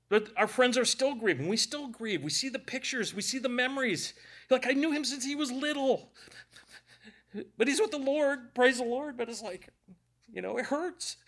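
high, tearful voice of real grief; crying. Real, not acted. Preacher grieving someone he knew since he was little, crying. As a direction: fight through tears as you speak. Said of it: "all that crying out of there".

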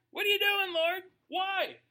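high, loud desperate cry; mock anguished cry. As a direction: wail it up at heaven. Crying out to God.

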